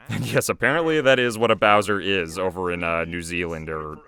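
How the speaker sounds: ordinary talk, nothing marked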